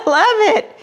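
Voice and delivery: laughing it out delighted; a giggle breaking through the words. Real, not acted. Vlogger opening a friend's card, laughing delightedly.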